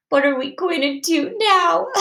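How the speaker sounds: mildly tearful, acted child voice; tearful question. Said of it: "I asked her through the tears".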